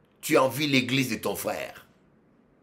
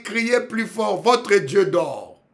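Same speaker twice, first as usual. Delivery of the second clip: loud, higher-pitched jeer; raised-voice taunting; mocking taunt; 9 semitones higher, 7 dB louder, slower than usual. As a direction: goad them to shout, slowing at the end. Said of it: "Élie rigolait".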